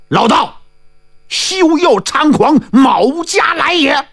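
drawn-out, high-pitched battle cry; high challenging shout. A narrator's reading. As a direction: call out a challenge across the battlefield, stretching the words. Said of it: "猛听迎面有人高喊".

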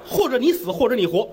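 ordinary delivery, nothing marked

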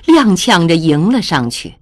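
ordinary delivery

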